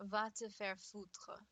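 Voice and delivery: "Va te faire foutre." said slowly in careful French; deliberate French phrase, slow and clear; French demo. Shown as a demonstration. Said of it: "I can translate that directly".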